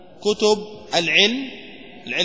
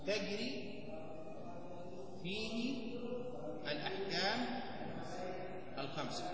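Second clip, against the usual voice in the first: each word spaced out with long pauses, much softer; soft, drawn-out dictation of تجري فيه الأحكام الخمسة; slow dictation; 21 dB quieter than usual. Shown as a demonstration. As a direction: dictate it slowly, word by word, for students to write. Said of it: "نكتب، نكتب العبارة هذه حتى تحفظونها".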